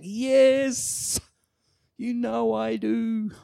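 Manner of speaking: high, slow, emphatic reply; emphatic yes. Retold or imitated. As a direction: insist on it, leaning into every word. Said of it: "responds with an emphatic".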